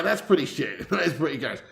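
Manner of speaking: usual speaking voice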